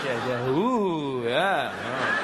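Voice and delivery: low, slow humouring voice; mock cop. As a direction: play a sceptical officer stringing him along. Mimics cops humouring his statement.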